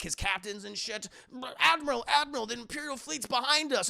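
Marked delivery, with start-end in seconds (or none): 1.6-3.9 high, loud urgent warning; urgent calling out. Acted. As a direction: call out to the admiral.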